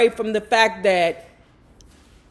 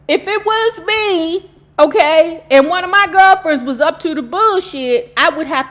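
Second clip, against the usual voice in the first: high, loud fired-up rant; heated rant; 7 semitones higher, 6 dB louder than usual. Real, not acted. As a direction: get worked up about it.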